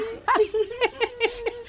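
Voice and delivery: high, lovestruck giggle; silly high-pitched giggle of delight; giddy high laugh. Acted. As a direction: giggle like a smitten teenager. Husband giddy alone after she leaves.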